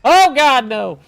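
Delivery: startled high yelp of alarm; panicked shout. Real, not acted. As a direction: cry out in sudden panic. Sudden panic in game.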